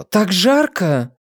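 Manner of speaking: slow whiny moan about the heat; whining. Acted. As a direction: drag it out, whining. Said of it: "заныла Нэнси". (капризно ноет)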